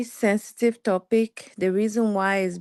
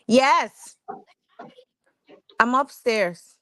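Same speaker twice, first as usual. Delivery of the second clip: yelling across the room; 5 semitones higher, much slower than usual. Real, not acted. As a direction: shout it across the house. Calling out to her kids.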